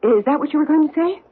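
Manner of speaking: high, pointed, sarcastic cut-in; sharp mocking challenge. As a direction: throw his own word back at him. Cuts him off, accusing.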